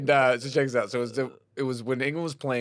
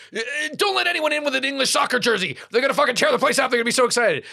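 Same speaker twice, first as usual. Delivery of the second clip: high-pitched mock panic; imitated freaking out; 12 semitones higher, 3 dB louder, faster than usual. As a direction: play the manager in a flap, pitched way up. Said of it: "like, freaking out".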